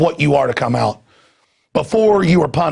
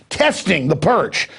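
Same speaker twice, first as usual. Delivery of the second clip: ordinary talk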